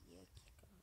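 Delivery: hushed tense whisper; whispering so the mob won't hear. Real, not acted. Hiding from a mob. (sussurro tenso)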